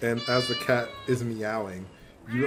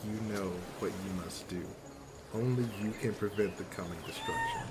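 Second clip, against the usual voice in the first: low, hushed, ominous whisper; cat whispering; 4 semitones lower, 10 dB quieter than usual. Acted. Cat whispers a warning in snuggler's ear, low and hushed. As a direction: murmur a secret prophecy into their ear. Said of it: "the cat whispers in your ear".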